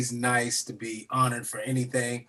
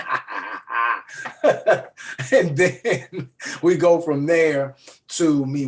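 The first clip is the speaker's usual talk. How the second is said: mischievous laugh; slower than usual. Retold or imitated. Mimicking his mischievous laugh.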